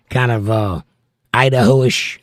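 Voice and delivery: ordinary talk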